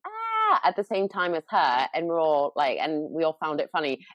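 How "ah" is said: joke scream. Retold or imitated. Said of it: "as a joke, I started going".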